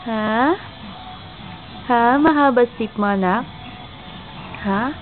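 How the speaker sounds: usual speaking voice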